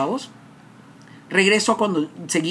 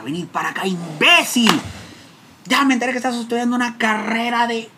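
higher, louder angry father; father's angry summons; 8 semitones higher, 4 dB louder than usual. Retold or imitated. Father scolding his son for studying psychology. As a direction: bark it down at the son. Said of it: "Mi papá se entera".

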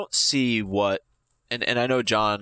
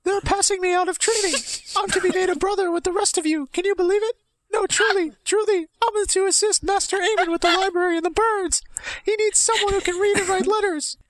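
very high, louder, fast excited squeak; Mickey Mouse voice; 4 dB louder than usual. Acted. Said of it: "Why do you sound like Mickey Mouse".

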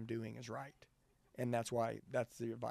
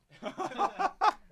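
loud laugh; 11 dB louder than usual. Real, not acted. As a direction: burst of high, loud laughter. Laughing at his own joke.

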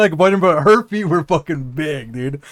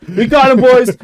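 much higher and louder, gleeful; mock triumph; 8 semitones higher, 7 dB louder than usual. Imagining the seniors' sting, gleeful shout. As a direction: cheer like the seniors caught him.

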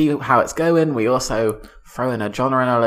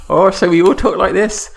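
mock Devon farmer accent, higher and louder; 7 semitones higher, 5 dB louder than usual. Asked for a farmer accent, [name] hams up Devon farmer voice. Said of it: "Can you do a farmer accent?".

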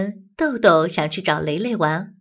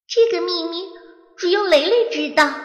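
hurt thought; 10 semitones higher than usual. Acted. Hurt inner thought before tears.

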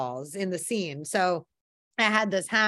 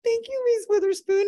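high, starstruck thank-you; 13 semitones higher, 4 dB louder than usual. Retold or imitated. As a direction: thank her like a giddy fan. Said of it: "And I was like".

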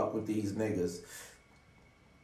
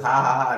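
loud mock laugh; 2 semitones higher, 9 dB louder than usual. Laughing off friends' calls.

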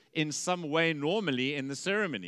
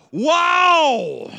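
a huge, much higher, much louder cry of wonder; joyful shout; 13 semitones higher, 14 dB louder than usual. Acted. Adam seeing Eve for the first time, a joyful shout. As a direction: shout it like a man who can't contain himself. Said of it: "And so he shouts out".

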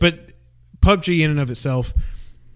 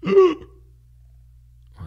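surprised gasp. Real, not acted. Sudden discovery, gasp.

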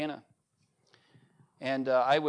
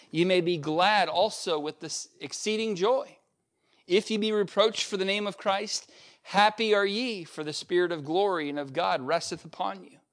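raised, solemn reading of the verses; scripture reading; 2 semitones higher, 4 dB louder than usual. Acted. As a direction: read it like a pulpit proclamation. Reads scripture passage aloud.

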